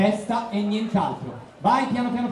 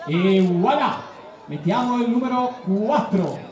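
ordinary delivery

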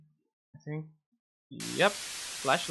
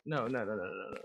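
fading; 7 semitones lower, 7 dB quieter, faster than usual. Real, not acted. Fading after the scare.

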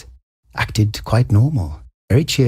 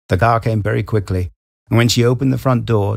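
plain reading voice

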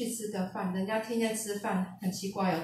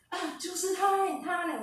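high-pitched retold exclamation of discovery; acted-out excited outburst, much higher; excited recognition; 9 semitones higher than usual. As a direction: imitate someone suddenly spotting the man, pitch leaping up. Mother suddenly recognises the man on screen.

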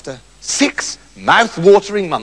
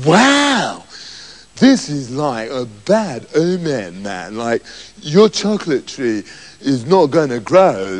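stoned hippie magician; 8 semitones lower, slower than usual. Acted. Hippie magician reads omen.

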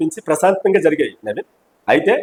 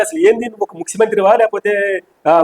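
ordinary talk

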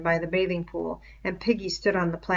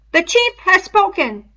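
loud, much higher chanted proclamation; spoken in unison; 12 semitones higher, 11 dB louder than usual. Acted. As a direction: chant it together like a war cry. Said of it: "spoke in time".

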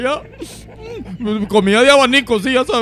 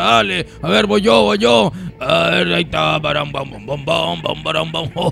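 rowdy low male voice; 6 semitones lower than usual. Acted. Man pushing his dance partner, rowdy low voice. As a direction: bark at her to shake it, low and rowdy.

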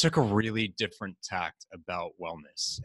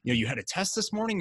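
imitating an eager coach; excited mentor; 11 semitones higher than usual. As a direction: insist warmly and brightly he did well.

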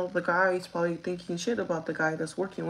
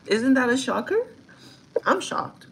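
shocked; 5 semitones higher, 5 dB louder than usual. Real, not acted. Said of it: "I'm really shocked".

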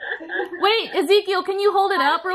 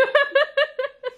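obnoxious laugh; 10 semitones higher than usual. Real, not acted. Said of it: "my obnoxious laughing".

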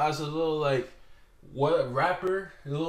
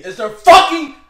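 real outburst, much higher and much louder; furious shout; 11 semitones higher, 19 dB louder than usual. Real, not acted. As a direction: explode on it. Furious rant about jumping.

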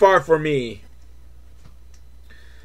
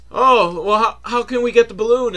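higher-pitched, hurried question; puzzled Oogies; 6 semitones higher, 3 dB louder than usual. Acted. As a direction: lightly voice eager, puzzled kids. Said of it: "they're like".

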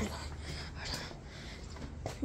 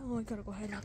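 whisper; 2 semitones lower, 12 dB quieter, much faster than usual. Real, not acted. Hiding from the seeker.

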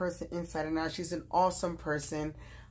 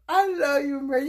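higher and louder, moved gratitude; touched outburst; 7 semitones higher, 7 dB louder than usual. Real, not acted. After reading Mary's card, moved and gushing. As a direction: gush with affection.

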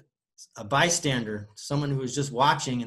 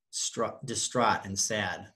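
ordinary delivery, nothing marked

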